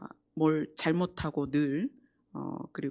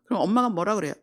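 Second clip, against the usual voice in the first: ordinary talk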